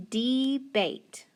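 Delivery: DEBATE read as "dee-bate" with a long E; stretched "dee" opening on DEBATE. Shown as a demonstration. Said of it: "so it's gonna be long".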